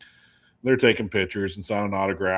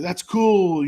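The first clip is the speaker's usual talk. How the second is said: put-on higher, friendly pep talk to a kid; encouraging rocker; 8 semitones higher, 4 dB louder than usual. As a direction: cheer the kid on, pitched up. Band member voiced in story.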